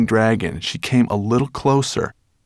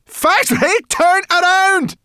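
high, drawn-out yell; urgent cry; 5 dB louder than usual. A narrator's reading. Sorrel to the hesitating dragon, urgent high cry. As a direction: cry out high, stretching the words. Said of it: "cried Sorrel".

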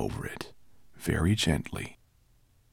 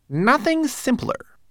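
high little manservant voice; fussy tiny homunculus; 4 dB louder, slower than usual. Acted. Twigleg proud.

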